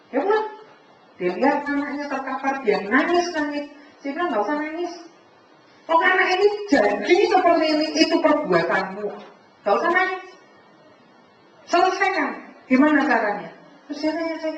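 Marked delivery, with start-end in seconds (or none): 4.3-13.5 loud, higher scolding rebuke; stern scolding. Retold or imitated. Preacher retelling scolding his crying niece's mother, loud and stern. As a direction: tell her off firmly, pressing her to fix it.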